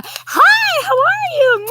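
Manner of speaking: very high, loud, sing-song hi; squeaky chipper hello. Retold or imitated. Wife greeting her husband, very high and loud. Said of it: "I'm like".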